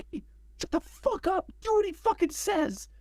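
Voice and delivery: high, held-back imitation of yelling; mock angry yell. Drummer defending the director to an intruder, mock yell. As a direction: act out a furious telling-off without full volume.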